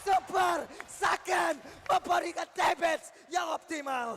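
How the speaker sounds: loud, slow, drawn-out hype call; fighting game announcer. Real, not acted. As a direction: stretch it out like an arena announcer. Hype caster calls triple kill.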